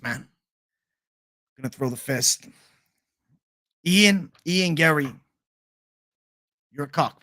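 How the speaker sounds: lightly put-on fighter impression, halting delivery; Sean Strickland. Streamer as a fighter taunting Ian Gary, loose halting impression. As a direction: loosely imitate a trash-talking fighter in short, separated bursts. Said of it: "Sean Strickland impression".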